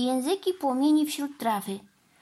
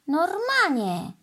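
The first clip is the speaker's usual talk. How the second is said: drawn-out, higher call of the name; prompting address; 7 semitones higher, 4 dB louder, slower than usual. A narrator's reading. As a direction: stretch the name to get his attention. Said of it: "zagadnęła do, go Mandy".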